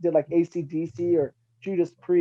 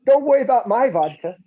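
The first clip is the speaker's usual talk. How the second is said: mock exasperated retort; 7 semitones higher, 4 dB louder than usual. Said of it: "I'm like".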